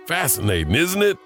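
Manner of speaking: ordinary talk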